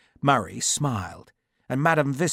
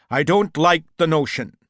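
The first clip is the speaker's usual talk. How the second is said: louder, higher grumbling general; 6 semitones higher, 10 dB louder than usual. A narrator's reading. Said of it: "Cathcart grumbled".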